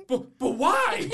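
loud, high, drawn-out mock dismay; appalled disbelief. Horrified instructor questioning the student, appalled disbelief. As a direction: stammer it out as a baffled, horrified teacher.

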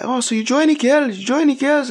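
high, lightly voiced driver impression; a hint of a chatty cabman; mock driver voice.

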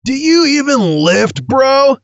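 cocky gym bro voice. Pretend. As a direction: swagger it like a lifter. Ad opener voicing a gym bro.

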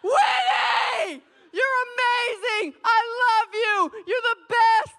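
lavish the dog with wild cheering; hammed-up ecstatic praise of the dog; overjoyed praise. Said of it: "literally be like".

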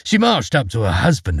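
ordinary delivery

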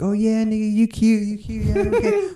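put-on high, teasing girl voice, pitched well up. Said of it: "They're like".

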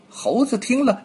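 usual speaking voice

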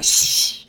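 sharp whispered shush; goblin shush. Acted. Goblin silencing the others, a whispered shush. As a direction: hush the others, goblin-style. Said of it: "and they go".

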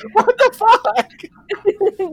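very high, stretched-out squawk; long comic cry of bafflement; incredulous outburst. Real, not acted. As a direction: draw it out in high-pitched disbelief. Outburst at absurd paperwork.

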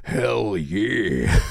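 a lightly done Arnold impression; a hint of an action-hero catchphrase; lightly voiced muscleman cheer. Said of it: "Let's put it in more like Arnold".

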